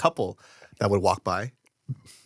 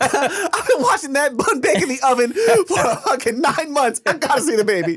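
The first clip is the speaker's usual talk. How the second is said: eager neighbor voice; 3 dB louder than usual. Acted. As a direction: play the baby-obsessed neighbor. Voicing the neighbor.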